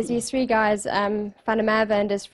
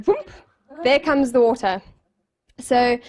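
ordinary delivery, nothing marked

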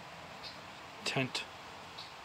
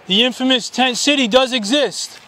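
higher, slow announcement to the camp; announcing; 9 semitones higher than usual. Real, not acted. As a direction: proclaim it to the people there. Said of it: "repeats phrase louder".

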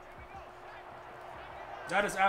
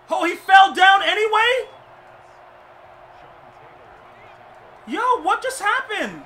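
much higher, louder, slow stunned yell; incredulous shout; 10 semitones higher, 9 dB louder than usual. Real, not acted. Player shouting at his football game, shocked disbelief. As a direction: stretch out the disbelief in a big yell.

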